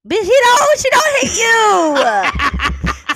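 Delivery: high, loud laughing shout; laugh-yelling in disbelief; shrieking with laughing frustration; exasperated yell. Real, not acted. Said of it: "I'm so mad".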